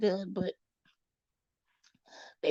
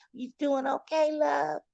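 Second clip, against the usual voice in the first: sugary nurse voice; 8 semitones higher than usual. Retold or imitated. Imitating nurse.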